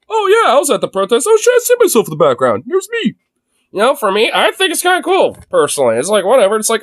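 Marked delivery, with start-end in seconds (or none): 0.1-3.1 higher, amused imagined self; light mock excitement. Streamer imagines spotting himself in protest footage, higher and amused. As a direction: play your own happy surprise, lightly. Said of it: "Be like".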